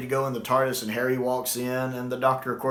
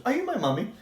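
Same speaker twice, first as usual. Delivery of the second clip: put-on much higher, childlike plea; child's gas mask voice; 11 semitones higher, faster than usual. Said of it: "puts on the gas mask and says".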